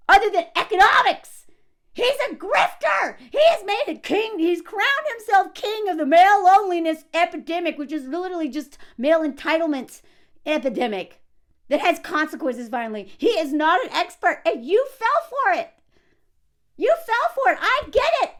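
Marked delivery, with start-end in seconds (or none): 0.1-7.8 high, raised-voice denunciation; worked-up, high-pitched outrage; indignant rant. Real, not acted. Rants against the podcaster. 14.6-18.3 high, insistent voice; worked-up and pointed; exasperated accusation. Real, not acted. Berates the commenter.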